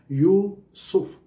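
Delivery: यूसुफ split into two drawn-out syllables, यू and सुफ; यूसुफ in two separate, stretched beats. Shown as a demonstration. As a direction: say यूसुफ slowly, one syllable at a time. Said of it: "इसमें दो syllable हैं".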